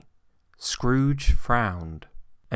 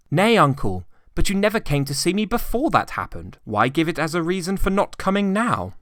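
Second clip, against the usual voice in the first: higher, brisk, friendly pleading; pleading nephew; 9 semitones higher, 4 dB louder than usual. A narrator's reading. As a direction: coax the stubborn uncle gently.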